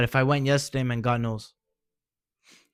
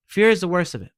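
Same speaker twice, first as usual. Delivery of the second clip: Fear hit hard and loud; emphatic, louder; 6 semitones higher, 6 dB louder than usual. Real, not acted. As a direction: punch the word Fear, higher and louder. Repeats the line for emphasis.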